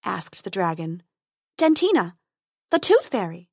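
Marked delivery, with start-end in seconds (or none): 1.6-3.5 fairy voice. Acted.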